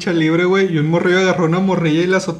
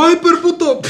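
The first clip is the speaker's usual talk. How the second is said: mock shout; 8 semitones higher, 4 dB louder than usual. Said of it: "le faltó gritarlo".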